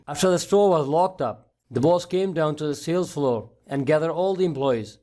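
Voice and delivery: plain reading voice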